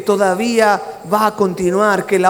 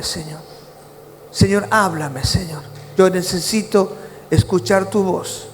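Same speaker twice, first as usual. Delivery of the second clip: guided prayer; 2 semitones lower than usual. Acted. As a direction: lead the room gently into confession before God. Leads the congregation in prayer.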